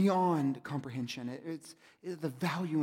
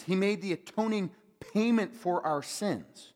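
ordinary talk

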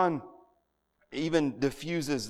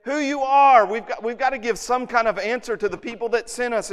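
raised, loud, insistent demand; pressing for answers; 8 semitones higher, 6 dB louder than usual. Retold or imitated. Questioners press John for who he is, insistent. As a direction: press hard for an answer, voice pitched up. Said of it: "so they press him".

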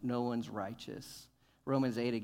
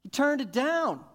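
higher, loud fiery preaching; preaching hard; 9 semitones higher, 8 dB louder than usual. Real, not acted. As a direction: drive the point home with fire. Preaching at full force.

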